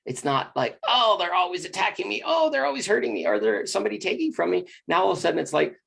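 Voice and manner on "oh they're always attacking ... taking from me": mock whiny victim. Mocking the victim mindset.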